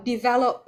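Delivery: "develop" with the beat on VEL; second-syllable stress. Shown as a demonstration. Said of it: "em sẽ nhấn nó ở cái âm hai".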